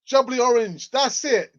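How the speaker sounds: loud, high, thrilled; excited. Real, not acted. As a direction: shout it out in delight. Thrilled rediscovering childhood drink.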